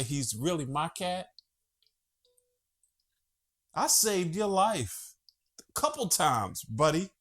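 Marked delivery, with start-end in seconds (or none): 3.7-7.1 tender to his pet. Real, not acted. Talking tenderly to his cat.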